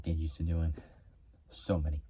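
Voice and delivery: normal talking voice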